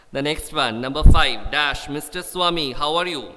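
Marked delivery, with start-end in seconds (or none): none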